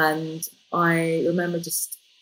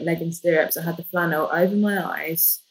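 ordinary talk

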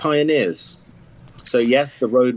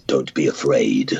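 solemn message; 10 semitones lower than usual. Acted. I just called to say.

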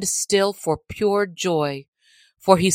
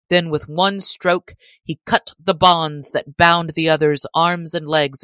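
ordinary delivery, nothing marked

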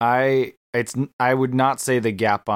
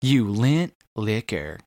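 slow savoring quote; slower than usual. Shown as a demonstration. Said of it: "title this one as You Lint Licker".

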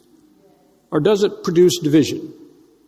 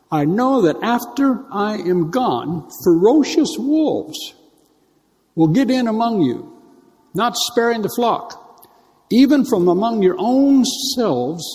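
slow and higher, weighty scripture warning; solemn warning; 6 semitones higher, slower than usual. Acted. Preacher warning the church of ferocious wolves, slow and raised. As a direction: draw out each phrase like a prophecy.

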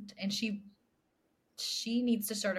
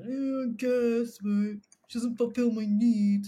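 whiny husband voice; 4 semitones higher, 10 dB louder, slower than usual. Retold or imitated. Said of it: "And he goes".

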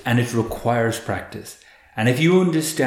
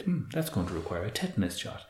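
softly understated, detached remark; distant, dispassionate; 11 dB quieter than usual. Retold or imitated. Quoting Homer after stepping on a nail, mildly dispassionate. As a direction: quote it coolly, as an observer of pain. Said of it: "That very sort of distant, dispassionate view on pain".

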